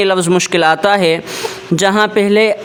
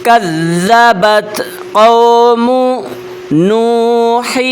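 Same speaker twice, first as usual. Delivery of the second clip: Quran recitation; much slower than usual. Shown as a demonstration. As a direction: stretch each vowel like a reciter. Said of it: "اس کو کس طرح پڑھیں؟". (آہستہ، بلند آواز میں تلاوت)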